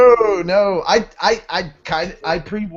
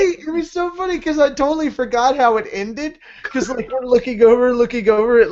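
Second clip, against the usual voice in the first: excited recounting; 8 semitones higher than usual. Real, not acted. Giggling while recalling video.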